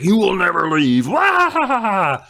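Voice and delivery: high, loud ghoulish threat and wail; spooky skeleton voice. Acted. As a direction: spook them like a ride skeleton. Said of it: "and he's just like".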